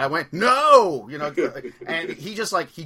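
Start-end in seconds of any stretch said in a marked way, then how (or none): 0.3-1.1 sudden loud, high "No!"; startled shout. Retold or imitated. Shopper recalls yelling back at the grabbing old man, loud and high. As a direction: blurt it out as if grabbed. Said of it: "in his same tone and being grabbed like that".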